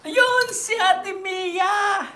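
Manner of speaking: loud, high, drawn-out cheer; excited shout-out. Real, not acted. Delighted streamer spots a viewer to greet, excited shout. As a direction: call the name out loudly, stretching it.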